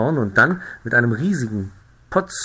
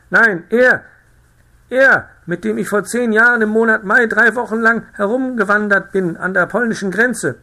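high, loud, excited insisting; agitated town crier; 11 semitones higher, 7 dB louder than usual. A narrator's reading. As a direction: press the point frantically. (aufgeregter Ausrufer, hoch und laut)

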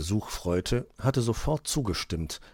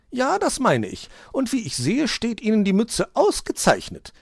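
very high, loud satisfied chirp; a bird praising the hat smugly; pleased magpie; 7 dB louder than usual. A narrator's reading. Frau Elster compliments the fox's hat, high and smug. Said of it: "erwiderte Frau Elster".

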